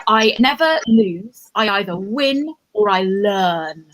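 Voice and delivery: slow, drawn-out delivery of a motto; solemn motto recital. Acted. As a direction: spell out the motto slowly, stretching the last word.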